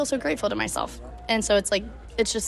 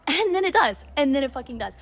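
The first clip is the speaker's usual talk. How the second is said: excited high; 6 semitones higher than usual. Real, not acted. The day gets even better.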